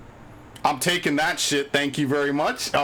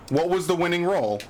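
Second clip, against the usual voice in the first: ordinary delivery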